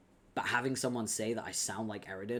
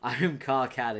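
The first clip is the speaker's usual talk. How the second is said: loud; 2 semitones higher, 7 dB louder than usual. Shown as a demonstration. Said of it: "Love how you just proceeded to demonstrate".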